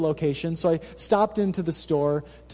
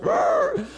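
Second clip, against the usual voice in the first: high growl of frustration; a playful little rage growl; comic frustrated snarl; mock angry growl. Coffee got wrong, anger rising.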